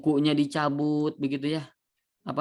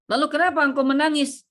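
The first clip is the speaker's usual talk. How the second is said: much higher and louder, pressing; exasperated question; 11 semitones higher, 5 dB louder than usual. Acted. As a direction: demand an answer, exasperated. Questioned repeatedly. (orang-orang bertanya dengan keras dan tinggi, tidak sabar)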